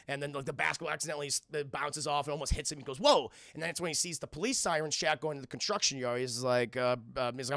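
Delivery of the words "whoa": quick, louder startled yelp; retold cry of surprise. Ball almost hits the invisible hero, startled.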